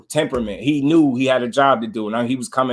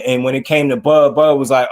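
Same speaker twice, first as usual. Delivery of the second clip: ordinary talk, nothing marked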